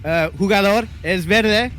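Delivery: high, slow Spanish announcer voice; lucha libre announcer. Acted. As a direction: call it out like a ring announcer. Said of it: "should we go in Spanish".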